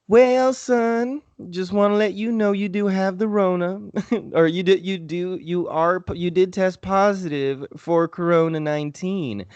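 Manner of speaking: put-on high-pitched little old Southern lady; cheery, high Southern drawl, hammed up; sweet Southern drawl. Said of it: "She had that nice little southern drawl".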